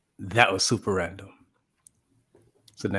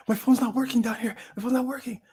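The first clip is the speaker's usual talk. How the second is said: high-pitched imitation of a panicked girl; mock-frantic, squeaky insistence; distressed whine; 14 semitones higher than usual. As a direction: plead in a put-on high voice.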